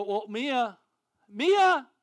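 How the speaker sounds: high, loud calling her name. Retold or imitated. Parents calling for their lost daughter, loud. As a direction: call across the crowd for Mia.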